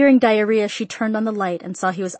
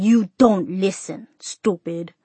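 father's scolding; much slower than usual. A narrator's reading. Father scolds his daughter afterwards.

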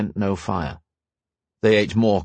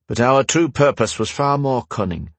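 ordinary delivery, nothing marked

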